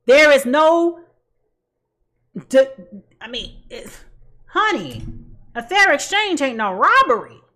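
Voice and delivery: ordinary talk, nothing marked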